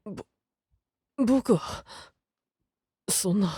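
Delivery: halting, faltering protest; flustered stammer. Acted. Young official stammering under accusation, flustered. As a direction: stumble over the words, trailing away.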